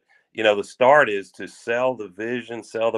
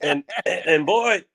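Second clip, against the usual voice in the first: laughing high through the words; 8 semitones higher, slower than usual. Real, not acted. Laughing while recalling goofy stunt.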